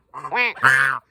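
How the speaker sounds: deep, loud quack-quack; low duck imitation. Woman quacks back at her ducks, low and loud.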